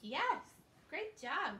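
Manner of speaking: ordinary talk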